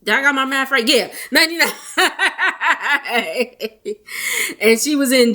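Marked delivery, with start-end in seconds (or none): 0.9-4.0 laughing. Real, not acted. Laughs at own math.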